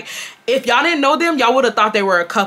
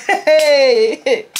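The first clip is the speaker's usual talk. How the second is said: imitated laughing; 8 semitones higher than usual. Retelling how he laughed while getting up, imitated laughing. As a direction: mimic a man cackling through it all. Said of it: "He's just laughing".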